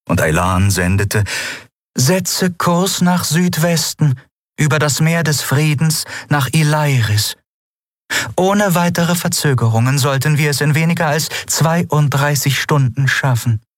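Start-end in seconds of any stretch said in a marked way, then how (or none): 2.0-13.6 ship's calm voice. Acted. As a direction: report the course evenly, a touch higher. Aylan sendet, Schiffsstimme. (ruhige, gemessene Schiffsstimme)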